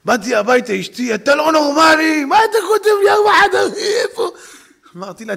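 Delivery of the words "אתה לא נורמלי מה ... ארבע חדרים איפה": higher, louder wailing wife; wife yelling. Retold or imitated. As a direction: scream at your husband in dismay. Wife yells at him. (אישה צועקת בבכי)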